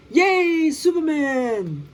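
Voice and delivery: high, drawn-out cheer for the hero; cheering crowd. Acted. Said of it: "the people cheered".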